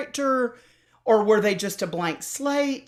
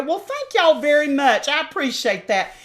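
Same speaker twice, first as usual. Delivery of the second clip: put-on big, higher-pitched Southern accent; heightened Southern twang, pitched up; turned-on Southern accent; 6 semitones higher, 4 dB louder than usual. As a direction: lay the Southern accent on as a polite thank-you. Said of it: "I would turn it on".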